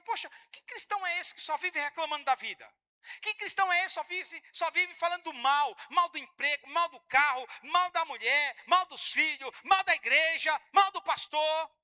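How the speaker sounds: loud, rising list of complaints; mock scornful critic. Mocking critics of complaining Christians.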